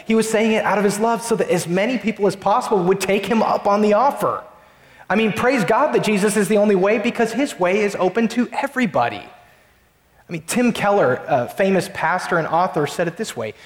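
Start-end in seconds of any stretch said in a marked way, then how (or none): 0.1-9.2 preaching at full force. Acted. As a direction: lift the congregation with passionate conviction. Preaching builds with rising pitch.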